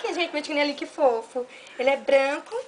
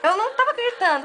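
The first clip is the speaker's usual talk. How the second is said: laughing; 7 semitones higher, faster than usual. Real, not acted. Laughing while retelling robbery.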